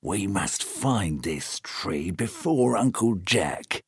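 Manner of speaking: hushed, slow plotting; very quietly. Acted. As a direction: conspirators plotting softly behind a curtain. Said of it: "said very quietly".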